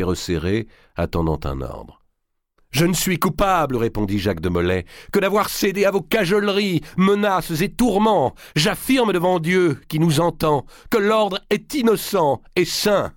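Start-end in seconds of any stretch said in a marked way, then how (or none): 2.7-3.8 voix haute et forte de grand maître; défense solennelle. A narrator's reading. Molay se défend devant le tribunal. 5.1-13.1 voix haute et forte de grand maître; défense solennelle. A narrator's reading. Molay se défend devant le tribunal.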